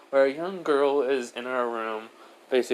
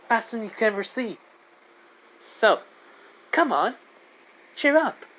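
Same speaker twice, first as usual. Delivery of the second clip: put-on high, eager child voice; high-pitched kid, peppy and reassuring; encouraging little girl Diane; 9 semitones higher than usual. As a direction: cheer up a friend as a small child.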